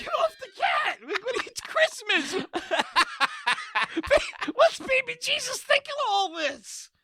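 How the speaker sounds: high, shouting and laughing at the dog; hammed-up panicked shout pulling the dog off; mock frantic yell. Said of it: "I was like".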